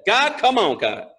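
exasperated acted plea to God; mildly begging; pleading. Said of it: "I pleaded with the Lord".

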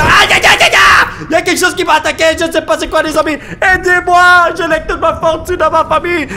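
high, loud pained yelps; panicked cries of pain. Real, not acted. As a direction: cry out under attack. Attacked by something. (cris de douleur paniqués)

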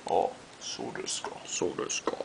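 hushed, whispered Japanese musing; mock sophisticated. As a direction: murmur it knowingly, without voice. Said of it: "you're so sophisticated".

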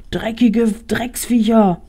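slow, snarling curse; angry growl. Acted. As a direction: spit it out in a rage. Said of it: "knurrte der Wolfsjunge zornig".

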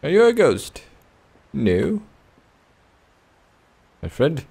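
ordinary talk, nothing marked

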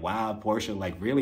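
low, soft disbelief; quietly appalled reaction; unimpressed. Retold or imitated. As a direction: drop the voice in disapproval. Retold disapproval of petty joke.

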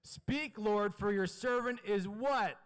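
emphatic preacher's shout, drawn out; say it like you mean it. Shown as a demonstration. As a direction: belt it out to rouse the congregation. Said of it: "Say it like you mean it".

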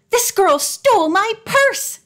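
shrill accusation to the guard; crying out. A narrator's reading. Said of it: "the woman cried out".

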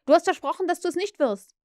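put-on betrayed boy protesting; Peter's hurt protest at grown Wendy; hurt reproach. A narrator's reading.

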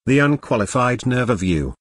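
ordinary delivery

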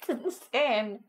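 ordinary delivery

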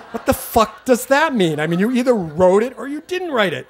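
loud, high, exasperated outburst; retold exasperated yelling. Exasperated child yelling at an evasive dad, acted out. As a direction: blow up in acted disbelief. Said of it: "'cause I'm yelling, screaming, getting hysterical".

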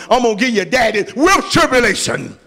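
ordinary talk, nothing marked

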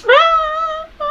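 high frightened screams; real scream. Real, not acted. Scream on VR coaster drop.

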